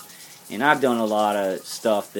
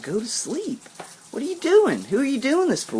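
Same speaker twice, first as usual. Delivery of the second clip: very high, strained, furious questions; real angry outburst. Real, not acted. Confronting the stalker directly, high furious outburst. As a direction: demand answers, voice cracking high. Berating the stalker right now.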